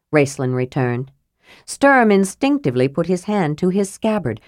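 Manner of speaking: ordinary delivery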